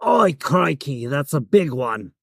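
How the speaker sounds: slow, stretched Australian impression; Steve Irwin. Shown as a demonstration. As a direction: put on a drawling Aussie accent. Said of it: "is Steve Irwin".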